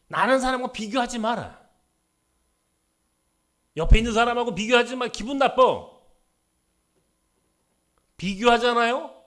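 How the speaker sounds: loud, high preaching; preaching at full force. Acted. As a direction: hammer the point home. Pastor preaching to congregation.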